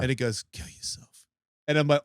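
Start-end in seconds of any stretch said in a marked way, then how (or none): 0.5-1.2 creepy whispered inner voice. Retold or imitated. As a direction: whisper it like a voice in the head. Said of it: "we're whispering it now".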